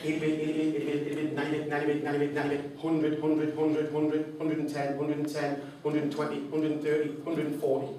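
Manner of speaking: fast rattling auctioneer call; auctioneer patter. Retold or imitated. Speaker imitates the auctioneer calling bids, rapid patter.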